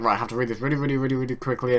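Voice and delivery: fast, breathless rush through the words; rushed reading. Retold or imitated. Retelling how the dialogue flashed past, hurried. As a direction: hurry like there's no time to read. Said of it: "I was just like".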